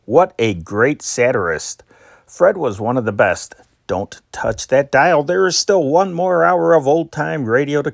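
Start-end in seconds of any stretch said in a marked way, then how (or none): none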